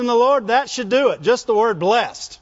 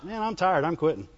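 mock tired; 6 semitones lower, 7 dB quieter than usual. Preacher mimics a tired jogger.

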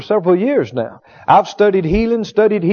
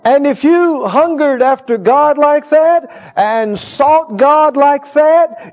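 slow, stretched, higher preaching; slow higher fervent plea; slow emphatic exhortation; 4 semitones higher, slower than usual. Real, not acted. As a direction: draw out each word to stir them.

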